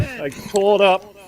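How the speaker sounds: normal talking voice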